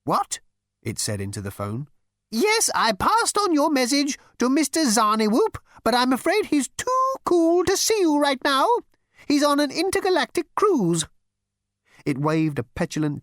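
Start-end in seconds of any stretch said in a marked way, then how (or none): 0.0-0.4 high, fussy insect voice; insect receptionist. Acted. 2.3-11.1 high, fussy insect voice; insect receptionist. Acted.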